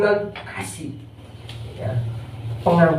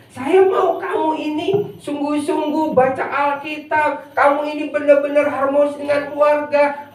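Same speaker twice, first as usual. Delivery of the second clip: high, insistent preacher voicing God; urgent exhortation, held high to the end; emphatic preaching; 5 semitones higher than usual. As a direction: pile up each demand on a sustained high pitch. Preaches in God's voice.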